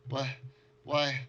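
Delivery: high, loud, panicked questions; frightened outburst. Acted. Survivor confronts the party, high and panicked. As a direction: recoil from strangers in terror.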